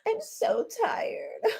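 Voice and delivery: hammed-up whimpering, as if turning on the waterworks; mock tearful. Tisha pleading about questions on her marriage, in exaggerated tearful whine. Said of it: "she put the tears on".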